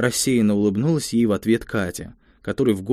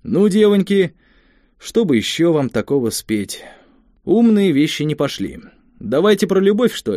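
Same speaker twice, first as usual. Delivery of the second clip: playful teasing; 6 semitones higher, 4 dB louder than usual. Acted. Kolka teasing the girls.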